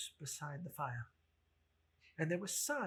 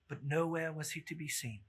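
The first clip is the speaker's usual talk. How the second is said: usual speaking voice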